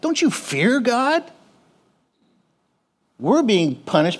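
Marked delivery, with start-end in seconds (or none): 0.0-1.2 high rebuke. Acted. Repeating the thief's rebuke, high.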